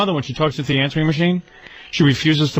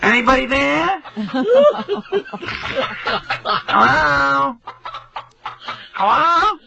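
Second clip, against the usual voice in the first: high, stretched-out calls into the phone; mother yelling hello; 10 semitones higher, much slower than usual. Retold or imitated. Mother calling into the answering machine, high and drawn out. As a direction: call out as if someone must answer. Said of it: "she's yelling into the answering machine".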